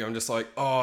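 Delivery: usual speaking voice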